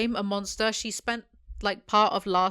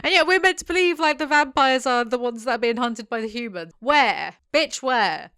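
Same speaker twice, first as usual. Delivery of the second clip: indignant rant; 4 semitones higher, 7 dB louder than usual. Real, not acted. Reader rants about vampires.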